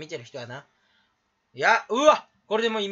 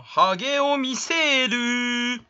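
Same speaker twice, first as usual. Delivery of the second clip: slow, higher stretched syllables; drawn-out announcement; 4 semitones higher, 4 dB louder, much slower than usual. Acted. Streamer shows off a bald head, slow comic announcement. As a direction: stretch every word like a reveal.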